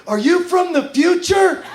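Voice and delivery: high, drawn-out cry to a startled cop; mock-frantic question, high-pitched; mock urgent shout. Jumps out at a cop.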